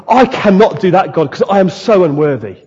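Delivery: emphatic, earnest confession of unworthiness; real humility, acted. Preacher voicing people humbled before God, earnestly emphatic. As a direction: plead it firmly, in earnest. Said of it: "This is real humility".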